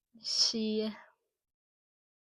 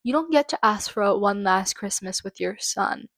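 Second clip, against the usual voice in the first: ordinary talk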